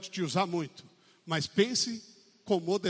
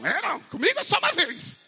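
mock curt refusal; 11 semitones higher, 7 dB louder than usual. Acting a grudge-holder.

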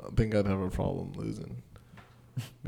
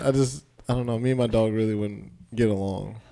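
ordinary talk

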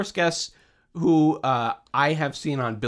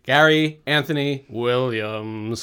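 announcer intro; 3 semitones lower than usual. Acted. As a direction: open the show like a grand emcee. Host introducing the show.

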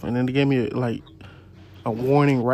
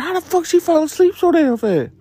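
imitating being startled; mock shocked; 12 semitones higher than usual. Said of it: "I was like".

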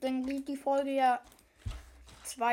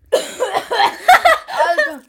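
high loud laugh through the word; cracking up mid-word; 8 semitones higher, 16 dB louder than usual. Real, not acted. Laughing after spicy bite.

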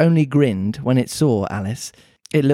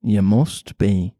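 put-on Mancunian accent; 4 semitones lower, slower than usual. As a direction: lower Mancunian cat voice. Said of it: "pretty Mancunian sounding accent".

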